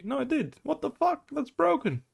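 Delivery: can't believe the game did that; real disbelief. Real, not acted. Coach seeing the poison tick, real high-pitched disbelief.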